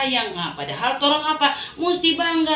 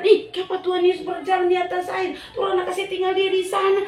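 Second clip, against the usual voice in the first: amazed exclaim; 7 semitones higher, faster than usual. Acted. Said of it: "Orang heran".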